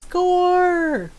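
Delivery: gleeful cheer. Real, not acted. Sneaking past enemy.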